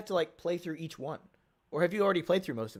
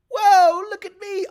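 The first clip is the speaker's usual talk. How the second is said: very high squealed whoa; amazed delight; 13 dB louder, slower than usual. Real, not acted. Player seeing they are a centaur, high excited squeal. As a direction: burst out in high-pitched wonder. Delighted at own game figure.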